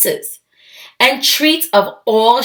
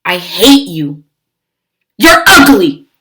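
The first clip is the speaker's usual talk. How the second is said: spiteful taunt; 2 semitones lower, 6 dB louder than usual. Acted. Lily secretly taunting baby.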